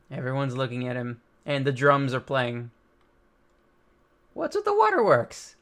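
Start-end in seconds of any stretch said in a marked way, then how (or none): 4.3-5.6 cartoonish high teasing line; high character voice. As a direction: tease someone crying in a squeaky voice. Character voiced mid-reading.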